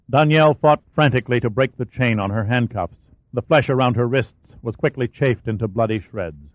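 ordinary delivery, nothing marked

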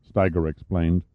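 ordinary delivery, nothing marked

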